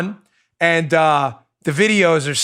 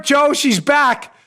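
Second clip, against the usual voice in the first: excited shout; 9 semitones higher, much slower than usual. Real, not acted. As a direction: shout it out in disbelief. Host stunned by a guest's return.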